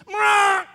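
very high, loud bird screech; long shrieked parrot call; parrot squawk. Acted. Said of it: "in all of his arrogance".

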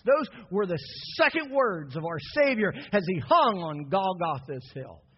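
ordinary delivery

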